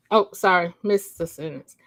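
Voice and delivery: ordinary delivery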